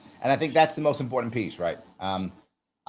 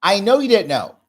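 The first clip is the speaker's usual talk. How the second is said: exasperated coach; 6 semitones higher, 6 dB louder than usual. Retold or imitated. Acted exasperated coaching exchange.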